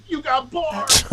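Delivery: high, loud, drawn-out cheer; imitating hype. Tour mates hyping his rapping, retold as a loud cheer. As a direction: holler it high and stretch the words.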